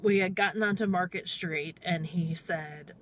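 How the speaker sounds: ordinary talk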